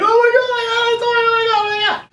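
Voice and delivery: very high, fast squeal; hammed-up shrieking excitement; overexcited kid. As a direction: gush in a mock-ecstatic squeal. Acts out thrilled child getting toy.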